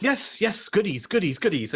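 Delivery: high, greedy excitement tumbling down in pitch; eager. Acted. Hart nodding and begging for goodies, eager. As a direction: pester excitedly for treats.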